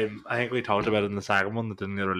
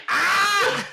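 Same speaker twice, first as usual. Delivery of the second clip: shocked cry; 5 dB louder than usual. Retold or imitated. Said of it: "everybody like".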